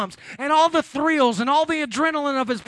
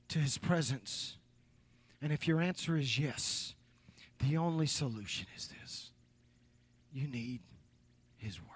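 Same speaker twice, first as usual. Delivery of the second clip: much softer and lower, sliding into a whisper; hushed appeal; 6 semitones lower, 12 dB quieter than usual. Real, not acted. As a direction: lean in and whisper the appeal to the congregation. Intimate hushed altar appeal.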